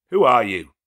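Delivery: loud sharp challenge; demanding shout. Acted. Rochester demanding of the intruder, loud sharp challenge. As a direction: fling the question at the intruder.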